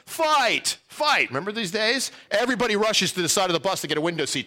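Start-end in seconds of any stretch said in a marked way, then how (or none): 0.1-1.3 high shouted call of fight; yelled. Retold or imitated. Kid on the bus yelling to the others, high shout. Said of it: "somebody on the bus yells".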